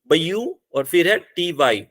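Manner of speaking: ordinary talk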